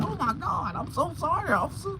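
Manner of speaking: squeaky high frightened sorry; high panicked apology. Retold or imitated. Apologising to the officer, high and scared.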